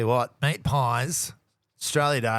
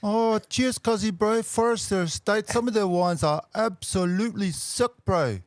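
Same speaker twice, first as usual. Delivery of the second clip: mock Kiwi accent; 7 semitones higher than usual. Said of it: "better than a haka".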